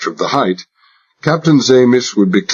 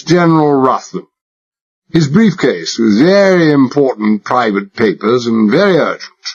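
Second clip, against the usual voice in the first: put-on high-class English accent laid on thick, higher; exaggerated British accent; 6 semitones higher than usual. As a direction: play the posh Englishman to the hilt. Narrator voices himself as posh Englishman.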